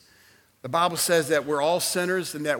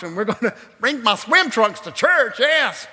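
excited; 5 semitones higher, 5 dB louder than usual. Real, not acted. Excited about the baptism.

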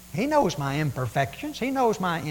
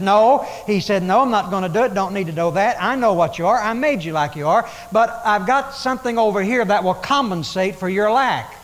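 louder, firm God saying no; God refusing; 4 semitones higher, 6 dB louder than usual. Acted. God answering the believer's plea, firm and loud. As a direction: shut the request down, then promise.

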